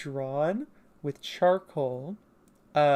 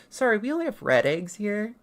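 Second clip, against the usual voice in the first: higher-pitched character reply; Murgo; 6 semitones higher, 4 dB louder than usual. Acted. Local telling a stranger about the eggs, light character voice. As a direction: answer in a lightly raised character voice. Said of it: "Murgo goes".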